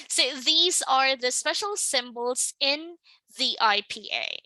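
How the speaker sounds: normal talking voice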